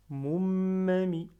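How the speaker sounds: MOUMAMI with a long, loud M before "ma"; doubled middle M. Shown as a demonstration. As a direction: hold back the middle M of "moumami". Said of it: "Je retiens ce mime". (m du milieu retenu et appuyé dans « moumami »)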